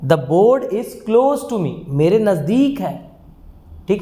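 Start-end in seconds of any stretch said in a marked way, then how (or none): 0.0-1.7 slow, deliberate model sentence; slow dictation. Shown as a demonstration. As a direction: say it slowly so students can copy. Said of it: "मैं क्या कहता हूँ?".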